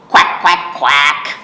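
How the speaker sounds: put-on loud playful quack for kids to copy; duck sound. Shown as a demonstration. Librarian leading children in the duck sound, loud quacks. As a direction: quack it out for them to join. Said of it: "Let's make our duck sound".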